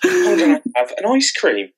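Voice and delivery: high, cheerful mock relief; high chirpy voice. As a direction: squeak it out, suddenly cheery. Voicing depressives around the world.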